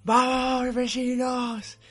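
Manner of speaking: high, drawn-out shout to the neighbours; grito por la ventana. Retold or imitated. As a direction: call out long and high, re-enacting a yell from the window. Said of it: "salí a gritar por la ventana". (grito agudo y alargado a los vecinos)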